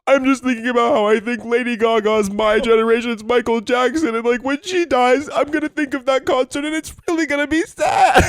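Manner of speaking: high, loud, wailing self-quote; mock sobbing self. Quoting herself to John, wailing high and loud. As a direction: wail it like a tearful mess. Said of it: "And I'm like".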